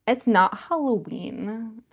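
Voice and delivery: slow, drawn-out mock complaint; mock hater. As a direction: imitate a nitpicking chat hater, dragging it out. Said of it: "like one of those haters".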